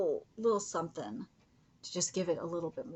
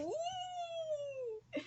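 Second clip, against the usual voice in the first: voicing the crowd; 8 dB quieter than usual. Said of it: "Everyone's like".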